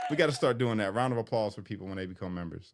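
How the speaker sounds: ordinary talk, nothing marked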